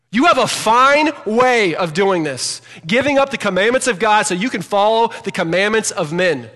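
Jesus yelling. Acted. Jesus yelling sarcastically at the religious leaders. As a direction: hurl it at the Pharisees with scorn. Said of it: "This is Jesus yelling at these guys".

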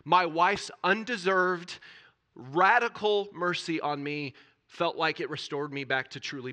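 testimony swelling into a sermon climax; impassioned preaching. Acted. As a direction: preach it with rising fervor. Testimony rising to full preaching.

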